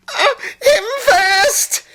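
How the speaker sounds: very high, drawn-out shriek; loud squealed demand; terrified shriek. A narrator's reading. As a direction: shriek in panic to send another first. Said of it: "shrieked Henry".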